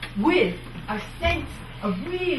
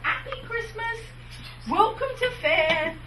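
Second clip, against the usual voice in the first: much higher, sweet Cockney welcome; innocent Cockney greeting; 9 semitones higher than usual. Shown as a demonstration. Showing fair performers a Cockney welcome to the fair, high and sweet. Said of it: "something very innocent like".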